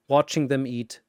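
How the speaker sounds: ordinary delivery, nothing marked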